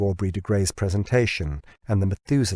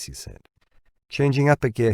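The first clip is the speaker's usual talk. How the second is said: ordinary delivery, nothing marked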